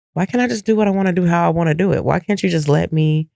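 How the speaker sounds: high, fast, fed-up pushback; exasperated protest. Real, not acted. To people judging how she has fun, high, fast exasperation.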